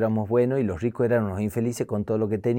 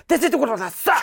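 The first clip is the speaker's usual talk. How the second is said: mock Japanese; 11 semitones higher than usual. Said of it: "una frase en japonés".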